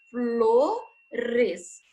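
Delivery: "flores" drawn out very slowly, keeping "fl" together. Shown as a demonstration. Teacher showing pupils that "flores" keeps "fl" together, very slowly. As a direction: stretch out "flores" with "fl" as one cluster. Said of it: "o F L vai continuar junto".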